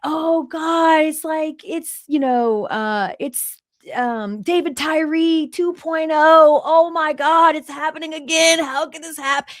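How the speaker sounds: high, slow, wailing mock panic of fans watching the game slip away; imitating a crowd of fans freaking out, high and wailing; imitating panicked fans. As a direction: ham up the dread of fans seeing disaster again. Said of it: "they start losing it".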